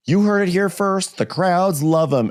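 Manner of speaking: high-pitched mock TV reporter; excited news reporter. Reporters covering the viral Santa, mock excited announcing. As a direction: hype it like breaking local news. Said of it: "The news is all abuzz".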